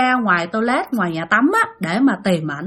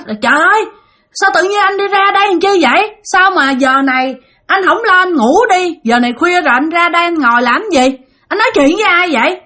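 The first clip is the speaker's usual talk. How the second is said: higher-pitched, mildly exclaimed scolding; retold crying out; 5 semitones higher than usual. Wife finding her husband squatting outside at night, mild retold outcry. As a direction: re-enact an alarmed outcry without really shouting. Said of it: "chị mới la lên".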